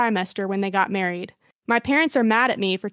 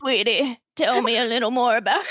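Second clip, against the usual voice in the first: put-on old lady voice. Shown as a demonstration. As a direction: coo it like a doting grandma. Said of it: "my old lady voice".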